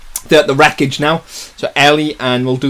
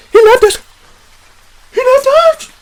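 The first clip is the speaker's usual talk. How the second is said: very high, loud scared shriek; panicked child; 5 dB louder than usual. Acted. Girl crying that she was left behind, panicked shriek.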